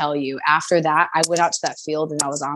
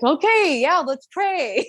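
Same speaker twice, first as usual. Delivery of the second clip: high, slow, dragged-out agreement; hammed-up grudging yes, pitched high; mock reluctant; 10 semitones higher, slower than usual. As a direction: pretend to go along with it, unconvinced.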